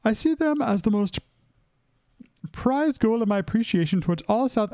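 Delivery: high, put-on reading voice; high-voiced amateur accent attempt. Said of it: "Why would I do it at a higher pitched voice?".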